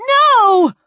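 high loud cry to stop; shouting no. Acted. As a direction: scream it out in alarm. Woman shouting to stop him.

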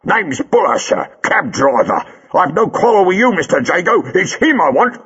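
high, rough working-class London voice, loud and demanding; a burly cabbie squaring up; cab driver Buller. A narrator's reading. Buller the cab driver to Jago, a high, loud demanding voice.

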